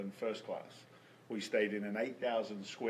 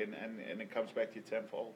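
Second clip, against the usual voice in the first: normal talking voice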